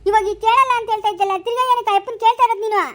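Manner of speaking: high, loud berating; angry scolding. Acted. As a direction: confront him for breaking his word. Berating him for telling anyway.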